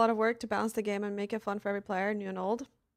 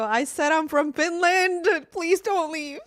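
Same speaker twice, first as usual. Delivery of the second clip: loud, slow, pleading yell, stressing Finland; mock pleading shout; 7 semitones higher, 7 dB louder, slower than usual. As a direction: shout it like begging them to stay. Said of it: "I go".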